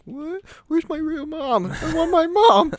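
very high mock-panicked wail; panicking child. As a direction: whine way up high like a frantic kid. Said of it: "I was, like, panicking".

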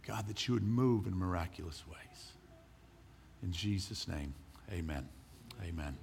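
low, soft earnest prayer voice; quiet prayer. Acted. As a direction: pray softly to the Holy Spirit. Pastor praying before sermon.

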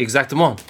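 exactement said the French way, eg-zak-teh-MAHN; a playful French exactement, quoting Lissau. Retold or imitated. Answering his co-host with Lissau's French exactement. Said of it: "Or as, uh, Lissau would say".

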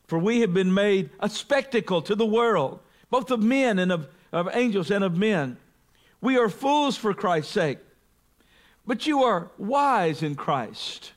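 high, biting ironic reading; mock the wise Corinthians; sarcastic. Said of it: "So sarcastic, by the way".

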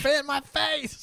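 high, slow squeal; squealed joke line. Retold or imitated. Friend adding a line for Becca, high slow squeal. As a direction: drag it out high and silly.

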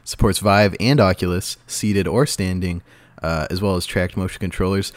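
ordinary talk

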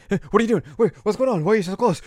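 high, loud panicky squeal; flustered Inuyasha. Retold or imitated. Inuyasha panics as Kagome leans close, high flustered squeal. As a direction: yelp it like a cornered teen. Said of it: "and Inuyasha's like".

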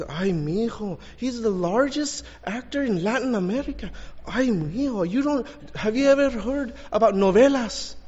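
high-pitched, incredulous imitation of a Mexican lady; shocked woman. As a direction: scold him in mock disbelief. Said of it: "She was shocked".

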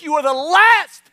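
loud, high, drawn-out mock verdict; emphatic aunt impression. Aunt judging her nephew's ministry plan, loud mock exclamation. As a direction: stretch and blast LAST, imitating the aunt.

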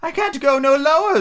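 high, fretful Hatter; nervous Hatter voice. Acted. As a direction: plead it high and thin.

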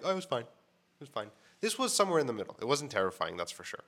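ordinary talk

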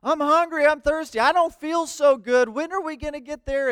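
high-pitched imitation of complaining children; mock screaming kids. Kids in the back of a lost car, mock wailing. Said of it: "kids are screaming in the back".

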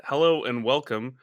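ordinary delivery

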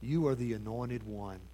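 hushed, sincere confession of faith; near-whispered, earnest declaration. Acted. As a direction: say it with quiet conviction. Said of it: "with all sincerity".

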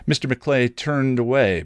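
plain reading voice

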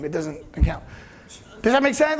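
ordinary delivery

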